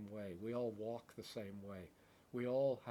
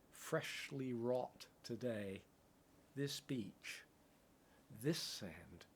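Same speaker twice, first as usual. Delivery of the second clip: slow, swelling verse that builds louder, then settles; rising poetic recitation; 2 semitones higher, 7 dB louder, slower than usual. Acted. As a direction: recite it like a prayer gathering strength.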